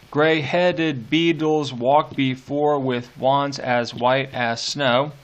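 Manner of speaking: slow, beat-by-beat reading of the line; slow metrical reading. Shown as a demonstration. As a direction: read it slowly, marking each beat. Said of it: "Another fourteen beat line".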